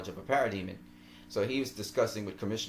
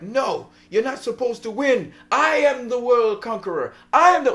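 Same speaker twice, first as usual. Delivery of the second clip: higher and louder, building defiant roar; villain defiance; 11 semitones higher, 10 dB louder than usual. Retold or imitated. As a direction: rage against losing. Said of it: "he's fighting".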